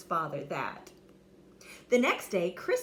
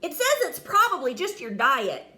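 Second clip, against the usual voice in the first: high knight voice; 5 semitones higher, 8 dB louder than usual. Acted. Knight reads book.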